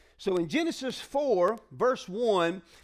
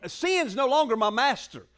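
ordinary talk

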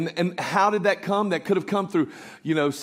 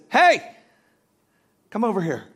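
high, loud "hey", then a quick summons; bird calling out; 6 semitones higher, 6 dB louder than usual. Acted. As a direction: holler to get a passerby's attention. Bird calls out to passing man.

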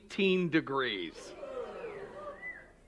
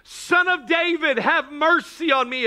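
high, raised plea for mercy; crying out; 11 semitones higher, 4 dB louder than usual. Acted. Bartimaeus crying out to Jesus for mercy, high loud call. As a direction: call out urgently over a crowd. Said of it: "he cried".

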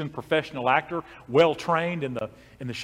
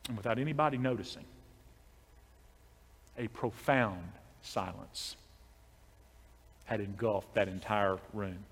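much softer, slowed, lower hush; hushed narration; 6 semitones lower, 11 dB quieter than usual. A narrator's reading. Preacher describing the church falling silent, hushed. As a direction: let the room go silent in your voice.

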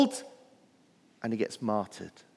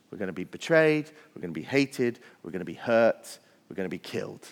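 mock gloomy thought; 7 semitones lower, 5 dB quieter than usual. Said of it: "you just think".

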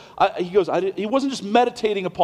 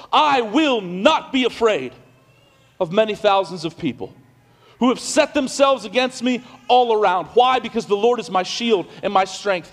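raised, ringing preacher's voice building to a declaration; full-force preaching; 4 semitones higher than usual. Acted. Pastor preaching the psalm to his congregation, full force.

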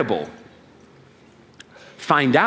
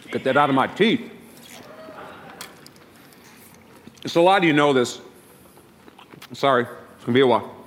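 mouth full; 4 dB quieter, faster than usual. Real, not acted. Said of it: "If I talk with my mouth full".

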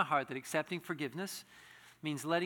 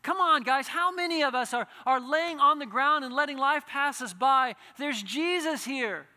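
louder and higher fervent preaching, Jesus speaking to the man; impassioned preaching; 8 semitones higher, 9 dB louder than usual. Acted. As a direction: pour out the call with rising fervour. Altar call to congregation.

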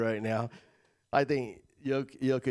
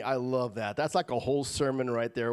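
ordinary talk, nothing marked

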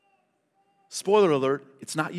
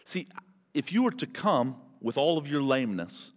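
ordinary talk

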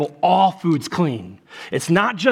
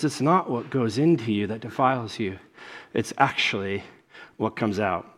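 low, soft and measured, with pauses; hushed solemnity; 6 semitones lower, 6 dB quieter than usual. Retold or imitated. Pastor quoting Jesus on what defiles, hushed and solemn. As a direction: let each phrase land quietly. Voicing Jesus's weighty saying.

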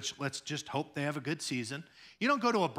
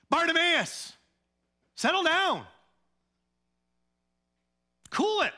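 shouted rebuke; 13 semitones higher, 5 dB louder than usual. Retold or imitated. Onlookers shouting at Bartimaeus to settle down, high and loud. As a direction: yell at him to cool it. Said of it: "onlookers rebuking Bartimaeus".

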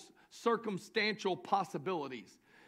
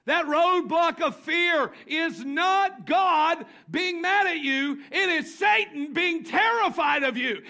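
shouted, higher preaching; preaching at full force; 9 semitones higher, 9 dB louder, slower than usual. Acted. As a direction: drive it home loud to the church.

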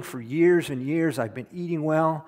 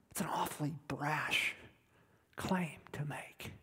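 whispered aside; 13 dB quieter than usual. Real, not acted. Hushed close to a point.

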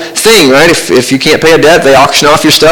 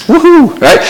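mock cheer; 13 semitones higher than usual. Mock celebration of feud ending.

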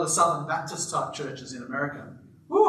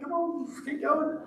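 mock shout; 10 semitones higher, 5 dB quieter than usual. Pastor imitating women in the congregation, high mock shout. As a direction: egg the preacher on, lightly.

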